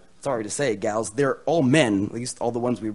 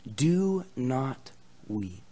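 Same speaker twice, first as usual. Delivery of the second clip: slow, soft consoling plea; gentle encouragement; 6 dB quieter, much slower than usual. Acted. Preacher repeats the elder's words to listeners, gentle encouragement. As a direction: comfort them softly and deliberately. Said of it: "my words of encouragement to you".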